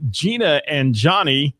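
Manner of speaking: normal talking voice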